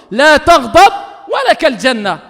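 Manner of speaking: loud, slow, measured Arabic; forceful preacher's declaration; emphatic proclamation. Retold or imitated. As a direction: proclaim it firmly, word by word. Repeats the answer as a command.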